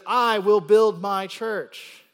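"I" hit very loud and high, the rest slow; loud, drawn-out emphasis led by "I"; emphatic stress. Shown as a demonstration. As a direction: hammer out "I will build my church". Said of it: "notice the emphatic emphasis".

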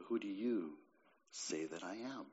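very soft and low, with a pause after "you"; quiet, searching question. Retold or imitated. Preacher voicing Jesus' question to the congregation, quiet and searching. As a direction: put it softly and personally to each listener. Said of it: "he would be asking you of this very question".

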